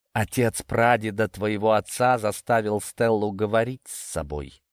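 plain reading voice

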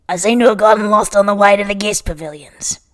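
loud, put-on Australian character voice; Australian accent. Yabai excusing himself to Xia, loud mock Australian accent. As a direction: play it up in a hammed-up Australian accent. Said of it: "having that accent".

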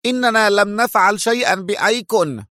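defensive retreating denial; nervous. A narrator's reading. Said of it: "يتراجع في عصبية".

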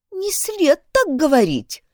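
affected, prim schoolgirl voice; prissy little voice. A narrator's reading. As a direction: say it primly and affectedly. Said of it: "жеманным голоском сказала Шейла".

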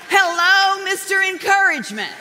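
"Hello, Mister Encouragement" up high and loud, dry sarcasm; sarcastic mock. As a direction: tease her husband with mock awe. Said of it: "And I'm like".

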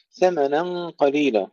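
slow, drawn-out chanted recitation; Quran recitation. Acted. Teacher recites the verse to students, slow tajweed chant.

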